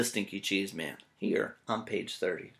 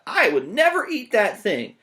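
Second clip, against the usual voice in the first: imitating a kid; 10 semitones higher, 9 dB louder than usual. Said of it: "Aiden shouting out".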